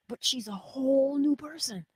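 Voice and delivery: unvoiced whisper, stress on new. Real, not acted. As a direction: breathe it out in awe. Whispered marvel about friend.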